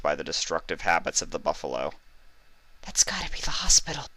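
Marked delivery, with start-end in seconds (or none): 2.8-4.1 high, whispered shy pony; whispered Fluttershy. A narrator's reading. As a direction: breathe it out, high and timid. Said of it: "Fluttershy said".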